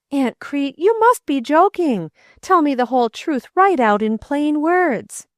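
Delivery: put-on a frightened boy fearing loss; anxious boy. A narrator's reading. Boy voiced in story.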